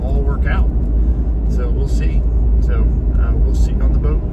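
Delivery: loud voice over workshop noise; shouting over machinery. Real, not acted. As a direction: raise your voice over the machines. Talking in a noisy workshop.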